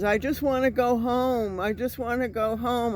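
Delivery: mock plea from outside the door; older man pleading. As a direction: imitate an out-of-it older guy pleading. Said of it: "He sounded like an older guy".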